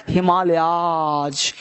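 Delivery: हिमालयाज drawn out slowly, ending in z; हिमालयाज spoken slow and clear; slow Himalayas. Shown as a demonstration. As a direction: stretch हिमालयाज slowly, landing on the final z. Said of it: "अंग्रेजी में क्या होता है".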